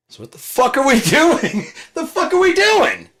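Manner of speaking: high, loud, laughing outrage; exasperated yell. Real, not acted. Ranting about the government, a high exasperated yell. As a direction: shout it in disbelief.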